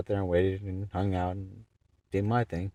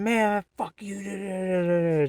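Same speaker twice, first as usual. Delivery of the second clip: high mocking voice of the cursing driver; imitating the driver cursing, high and mocking; mock angry guy; 13 semitones higher, 4 dB louder than usual. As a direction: lampoon him cursing, high.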